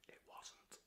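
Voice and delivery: a dry, whispered correction; whispered aside. Real, not acted. Vlogger whispering a deadpan correction to viewers. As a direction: breathe it to the camera as a deadpan aside.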